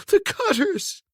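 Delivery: high, tearful cry; sobbing. A narrator's reading. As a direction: sob out the words. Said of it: "she sobbed".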